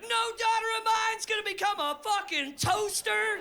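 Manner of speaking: high, hammed-up outraged shout; ranting father. Outraged dad forbidding his daughter to become an appliance, high ranting shout. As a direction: lay down the law in a strained, high-pitched rant. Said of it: "I go on a fucking rant".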